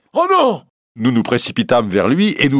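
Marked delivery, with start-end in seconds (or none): 0.1-0.7 very high-pitched cry of dismay; a shocked wail leaping far up in pitch; horrified cry. A narrator's reading. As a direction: cry out in sudden horror. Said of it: "et poussa un horrible cri".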